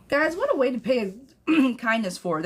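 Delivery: ordinary delivery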